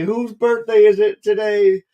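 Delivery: loud, high stage call to the crowd. Retold or imitated. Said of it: "[name]'s all like".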